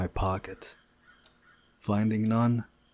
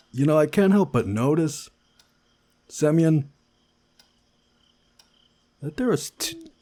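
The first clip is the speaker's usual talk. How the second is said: higher and louder, mock-helpful; teasing guy; 7 semitones higher, 8 dB louder than usual. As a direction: needle him about the beds.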